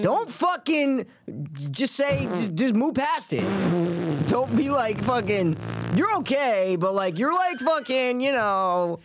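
high, slow hillbilly comic drawl; high slow mock comic drawl; comedian voice impression. Shown as a demonstration. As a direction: play the town hillbilly slowly. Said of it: "Give me yours".

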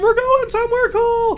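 put-on high, slow, gushing girl voice; dreamy high squeal of delight; excited girls. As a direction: swoon over the trip like a contestant. Girls excited about travel.

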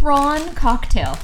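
high, drawn-out Prawn cocktail; high mock exclamation. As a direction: stretch it out, high and amused. Mocking the British flavor name.

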